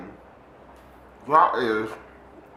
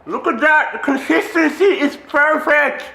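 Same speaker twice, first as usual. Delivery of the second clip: overjoyed; 10 semitones higher, 5 dB louder than usual. Real, not acted. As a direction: squeal with delight, stretching the words. Overjoyed by the consistency.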